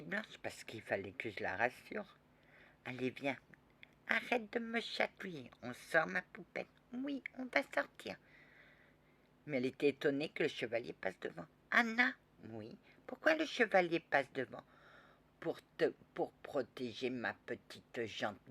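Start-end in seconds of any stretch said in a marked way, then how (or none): 4.1-8.2 put-on higher, light little girl voice; a four-year-old girl answering. 11.7-14.6 put-on high, louder child's question; a small girl puzzled by the knight; little girl voice. Child asks about the knight.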